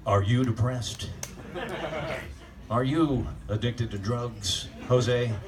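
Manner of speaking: mock a gentle rehab-ad announcer; lightly voiced soothing commercial narrator. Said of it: "The guy's got that soothing voice".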